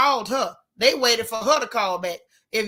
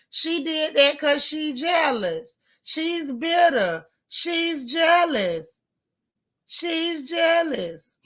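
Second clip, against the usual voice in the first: high, very drawn-out mocking chant; mocking accusers; 4 semitones higher, much slower than usual. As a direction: stretch each accusation out, mimicking the critics. Mimicking everybody's accusation against the mother.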